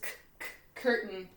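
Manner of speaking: isolated "k" sounds, then the whole word "curtain"; hard K sound. Shown as a demonstration. Teacher showing hard C, "k, k" leading into "curtain". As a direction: sound out the first K of "curtain" twice before saying it. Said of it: "but you hear the K sound".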